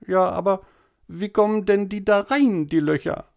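put-on high, whining little boy voice; whiny boy. A narrator's reading. Tobi whining to mama at dinner, high and whiny. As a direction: whine like a small boy pestering mama.

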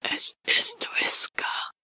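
drawn-out, pressed whisper; stifled, voiceless dread; very slow, strained. A narrator's reading. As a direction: force it out slowly, as through a suffocating veil. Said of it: "Dann ganz langsam".

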